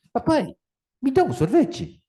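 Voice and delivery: put-on high, pleading little kid voice; a small boy begging his dad for ice cream; child asking. Boy asks his father for ice cream, high childlike plea.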